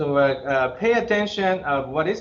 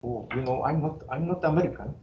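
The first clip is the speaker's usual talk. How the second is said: feigned denial. Retold or imitated. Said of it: "identity crisis switch".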